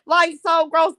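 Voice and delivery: usual speaking voice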